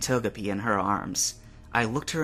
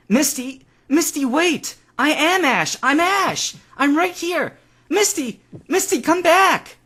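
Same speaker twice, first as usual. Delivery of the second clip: much higher, very loud pleading shouts; desperate calling; 8 dB louder than usual. A narrator's reading. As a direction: call after her as she leaves. Ash desperately calling Misty.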